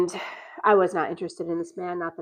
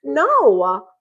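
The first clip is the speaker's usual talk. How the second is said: higher and louder, venting at him; heated rant; 7 semitones higher, 7 dB louder than usual. Retold or imitated. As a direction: let him have it, fed up. Ranting at the guy.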